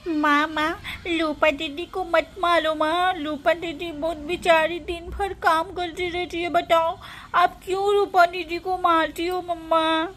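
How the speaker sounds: put-on high, whining child's plea; acted upset young sibling; pleading child. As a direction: beg mother tearfully in a little child's voice. Child begs mother to stop hitting.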